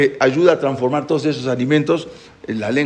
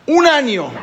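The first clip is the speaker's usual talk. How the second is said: exclaimed; 11 semitones higher, 4 dB louder, slower than usual. A narrator's reading. Exclaimed time jump.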